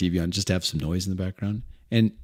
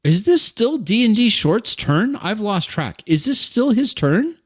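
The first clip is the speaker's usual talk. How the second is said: high, loud, slow confused question; confused outburst; 11 semitones higher, 5 dB louder than usual. Real, not acted. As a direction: blurt it in disbelief.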